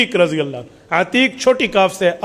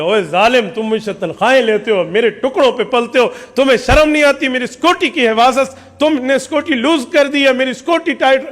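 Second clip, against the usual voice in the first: higher, building indignant scolding; scolding rant; 5 semitones higher than usual. Retold or imitated. Chief berating his servant for failing to guard him. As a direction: tear into the servant, getting angrier. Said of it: "او پورا چڑھ گیا جیسے اس نے کہنا تھا".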